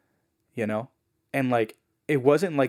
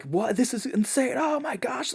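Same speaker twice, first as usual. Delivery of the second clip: much higher, rising cries of amazement; excited outburst; 13 semitones higher than usual. Retold or imitated. The family reacting to the neighbor news, exploding with excitement. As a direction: burst out in astonished excitement. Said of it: "they exploded".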